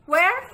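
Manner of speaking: "Where" pitched high and rising. Shown as a demonstration. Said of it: "High tone".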